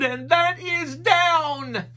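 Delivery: high, loud, slow shout; yelling above the wind. A narrator's reading. Shaggy yelling to Scooby over the wind, slow, high and loud. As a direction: bellow it slowly over the storm. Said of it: "Shaggy yelled above the wind".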